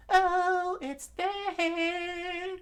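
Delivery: put-on high child voice. Young Sanji insists.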